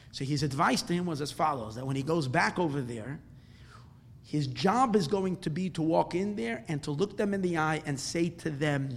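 usual speaking voice

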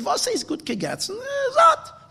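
high, puzzled simple man's voice; simple attendant's reply. Acted. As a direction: sound baffled by the question. Simple attendant answers the Rebbe.

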